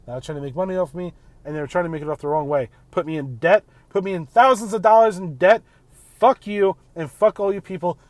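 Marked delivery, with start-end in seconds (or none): 2.9-8.0 louder, rising furious rant; angry yell. Real, not acted. Venting to listeners about medical debt, angry shouting. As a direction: hurl it out in real anger.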